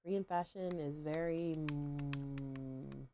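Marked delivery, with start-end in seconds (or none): none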